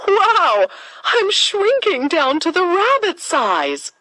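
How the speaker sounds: put-on high, excited girlish exclamation; high-pitched wonder at suddenly getting small; amazed. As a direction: sound astonished as she shrinks. Alice shrinks after eating cake.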